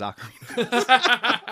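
high laughing words. Real, not acted. Laughing at the setup.

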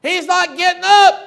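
loud high yell; shouted news. Retold or imitated. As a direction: holler it across the field. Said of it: "gets up and goes".

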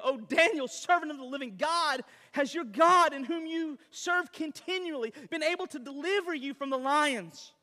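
loud, high anguished calling; anguished cry. Acted. King cries out to Daniel in the den, loud anguish. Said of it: "cried out in a tone of anguish".

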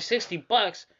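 loud, high indignant rant; mock furious complaint; outraged disbelief. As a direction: protest the price, incredulous. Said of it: "I'm like".

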